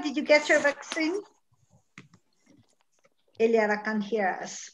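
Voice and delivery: normal talking voice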